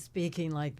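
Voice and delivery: low, heavy dramatic voice; deep voice. Shown as a demonstration. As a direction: speak low like Katharine Hepburn. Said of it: "I felt this deep voice".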